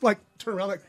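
usual speaking voice

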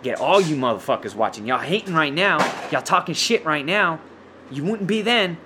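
ordinary talk

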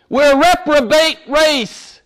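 ordinary delivery

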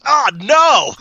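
dismayed shout. Retold or imitated. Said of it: "And then he goes".

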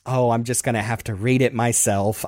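normal talking voice

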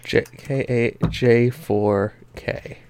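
slow, soft, spelled-out repeat; slow careful read-back. Shown as a demonstration. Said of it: "dictating the code".

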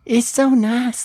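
high, slow Southern lilt. Pretend. Friend keeps up the new voice, high Southern accent. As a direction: stretch it out in high Southern tones. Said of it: "like, Southern".

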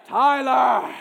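loud, drawn-out punchline; punchy shout with a falling end; shouted reveal. Retold or imitated. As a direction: announce it like a big reveal.

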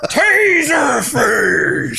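long, high, stretched-out yell of the name; drawn-out shout. Pretend. Hosts goofing on a villain name, stretched high shout. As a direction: bellow the name slowly, way up high.

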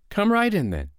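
very high, singsong invitation; high storybook woman's voice; unruffled foster mother. Acted. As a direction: pipe it up sweetly and politely. Foster mother invites giant inside.

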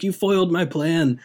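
a hint of a foiled villain, high and loud; mock French villain. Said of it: "I'm not gonna do a French accent".

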